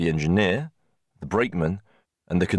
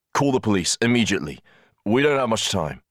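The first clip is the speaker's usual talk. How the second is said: quick, higher-pitched command; urgent order; 4 semitones higher than usual. A narrator's reading. Conductor sending for police before departure, urgent. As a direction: press them to act at once.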